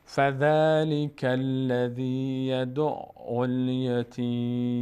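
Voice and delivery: slow chanted recitation ending on a silent م in الیتیم; Quranic recitation stopping. Shown as a demonstration. Teacher recites the verse to students, pausing at the end. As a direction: recite slowly and stop on الیتیم.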